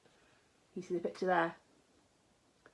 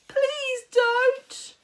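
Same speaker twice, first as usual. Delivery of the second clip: put-on much higher, louder pleading boy; 6 dB louder than usual. A narrator's reading. George begging Grandma to stop. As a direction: beg desperately. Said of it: "he prayed".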